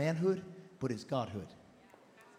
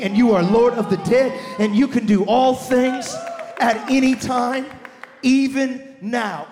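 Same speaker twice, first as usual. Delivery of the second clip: full-force preaching; 12 dB louder than usual. Real, not acted. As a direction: preach it out loud to the whole room.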